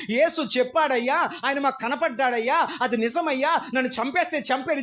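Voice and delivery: lightly voiced martyr answering; defiant Peter. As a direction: stand firm though they kill you.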